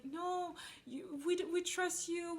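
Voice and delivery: high, lightly voiced coworkers insisting; voicing coworkers who say they trust him; coworkers protesting. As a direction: play the colleagues brushing it off. Coworkers' reply voiced higher.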